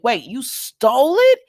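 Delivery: loud, rising incredulous question; retold outraged disbelief, high and loud; shocked disbelief. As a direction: imitate her shock, voice shooting up. Retelling Summer's shock at theft.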